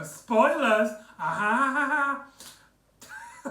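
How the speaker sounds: drawn-out "spoilers", then a high, put-on laugh; mock-flirty "spoilers" with a silly laugh; teasing laugh. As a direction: tease like River Song, then cackle. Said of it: "I don't know what that was".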